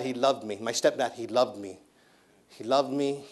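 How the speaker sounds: normal talking voice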